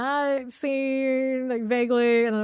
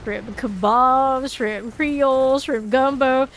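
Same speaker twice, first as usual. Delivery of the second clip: put-on loud, slow, higher Southern accent reciting shrimp dishes; Southern drawl shrimp list; 3 semitones higher, 7 dB louder than usual. Gamer quoting the shrimp list, in a loud Southern accent. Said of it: "You gotta say it that way".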